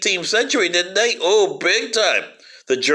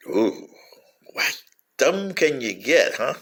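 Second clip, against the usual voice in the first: slow scornful whisper; whispered disbelief; mocking whisper; 4 semitones lower, 3 dB quieter, slower than usual. Real, not acted. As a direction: hush it like a sneer. Scornful aside on the West.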